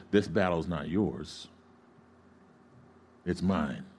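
low, soft, slow reassurance; lightly voiced Holy Spirit. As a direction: speak as God quietly taking charge. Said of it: "the Holy Spirit said".

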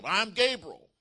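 high, booming retort; emphatic shout. Acted. Angel answers doubting Zacharias, emphatic shout. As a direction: thunder it back with authority. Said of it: "Gabriel's now emphatic".

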